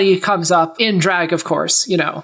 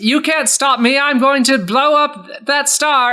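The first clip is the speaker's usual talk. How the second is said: a raised, higher villain's threat, slow at the end; 6 semitones higher, slower than usual. Acted. Soren threatens Bugs Bunny, raised villainous voice. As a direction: gloat that nothing can stop him.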